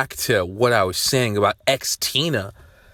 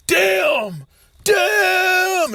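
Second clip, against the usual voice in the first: high, long-held dramatic shout; movie-scene yell. Retold or imitated. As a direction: imitate an anguished movie cry, stretching it out. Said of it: "Teenage Mutant Ninja Turtles movie".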